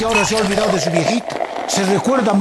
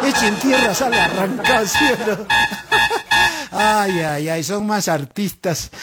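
ordinary delivery

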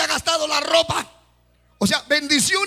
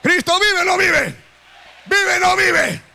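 loud, higher call, slow at first; preaching shout; 4 semitones higher, 4 dB louder than usual. Real, not acted. Preacher calling the congregation to answer, loud and high. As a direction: challenge the crowd, stress vive.

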